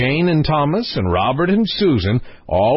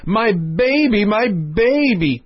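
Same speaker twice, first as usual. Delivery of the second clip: screaming nurse; 9 semitones higher, slower than usual. A narrator's reading. Nurse rushing about the palace for the lost princess, restrained scream. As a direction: cry it out high, drawing out the last words.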